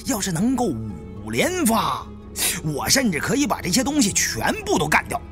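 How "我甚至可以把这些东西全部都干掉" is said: ordinary delivery, nothing marked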